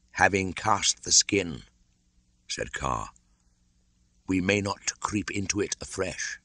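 put-on cold old python, matter-of-fact; snake voice. Kaa states the law.

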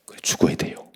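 soft unvoiced whisper; whispered resolve. Real, not acted. Preacher whispering to the congregation that he must die to himself. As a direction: breathe it like a private vow. Hushed aside in sermon.

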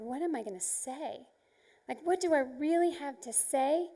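ordinary talk

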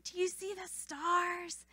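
put-on gently asking a little child. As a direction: ask it like you hold a toddler. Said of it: "I said".

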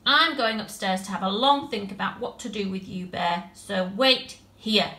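put-on angry little girl. Tilly scolds the bear after the mess, angry scolding. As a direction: spit it out, cross and hurt.

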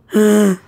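sharp startled gasp; loud gasp. Acted. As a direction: gasp in sudden shock.

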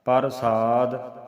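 ਪ੍ਰਸਾਦ drawn out slowly with a clear s; slow careful pronunciation. Shown as a demonstration. Teacher modelling the word for students. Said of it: "ਪੱਪੇ ਦੇ ਪੈਰ 'ਚ ਰਾਰਾ ਹੈ".

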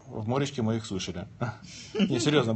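ordinary delivery

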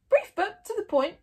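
high, loud, covering remark; hasty addition. A narrator's reading. Gobber defending the brief book, hastily added. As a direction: add it hurriedly in defence. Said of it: "he added hastily".

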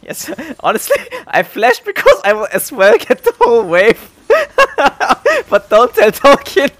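high, loud giggly laughter through the words; laughing hard. Real, not acted. Streamer confesses to chat, laughing hard and high. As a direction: crack up while confessing.

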